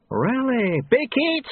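high query, then a squeal; question, then demand like a toddler; very high childlike outburst; babyish abbot. A narrator's reading. Abbot doubts, then baby outburst.

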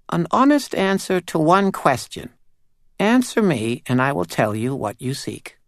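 high, tiny, eerie doll voice; a small uncanny doll spirit speaking. Acted. Doll sets her price.

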